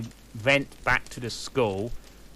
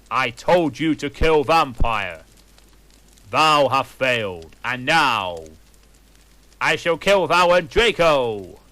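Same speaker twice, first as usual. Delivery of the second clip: villain threat; 7 dB louder than usual. Acted.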